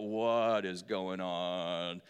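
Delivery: drawn out like a reader losing the will to go on; bored bewilderment. Retold or imitated. Mimics bored Bible reader.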